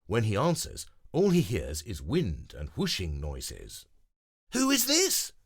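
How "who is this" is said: very high loud call; yelling into phone. Acted. Grover yelling into a windy phone. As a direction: shout over wind. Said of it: "Grover yells into the phone".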